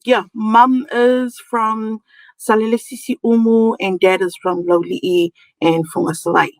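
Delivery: ordinary talk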